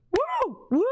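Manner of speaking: high celebratory woo; cheering whoop. Real, not acted. Host reacting to a viewer's praise, cheering whoop.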